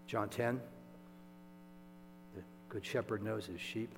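ordinary talk

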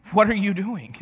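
acted cry of disbelief; exasperated scolding. Scolding a forty-year-old in a mess, exasperated shout.

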